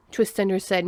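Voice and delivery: plain reading voice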